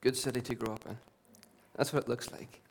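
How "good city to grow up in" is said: softer, reflective repeat; quiet echo. Shown as a demonstration. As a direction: let it sink in, gently.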